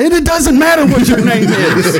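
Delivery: high, loud laughing cut-off; cutting him off. Retold or imitated. Bill collector cutting off a caller, laughing and loud. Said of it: "and I".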